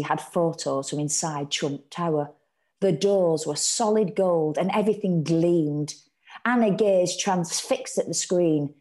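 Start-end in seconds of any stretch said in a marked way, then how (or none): none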